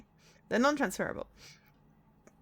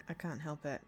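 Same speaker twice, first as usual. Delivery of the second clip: ordinary talk, nothing marked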